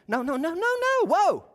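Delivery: high run of repeated noes; emphatic refusal. Acted. Jesus stopping a negotiating follower, high emphatic refusal.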